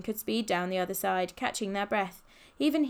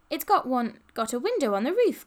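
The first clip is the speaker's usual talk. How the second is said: higher, louder exclamation; excited cry; 4 semitones higher, 5 dB louder than usual. A narrator's reading. Polly peering at the Golf, excited cry. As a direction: cry out at a wonderful discovery. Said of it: "cried Polly".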